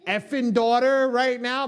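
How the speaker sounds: mock yell. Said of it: "I was like, "Honey, come grab your".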